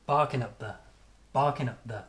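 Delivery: "Barking up there" with strong "Bar", the rest reduced; quick weak syllables after "Bar" in "Barking up there"; strong-weak rhythm. Shown as a demonstration. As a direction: hit "Bar" and let "up there" fall away weakly.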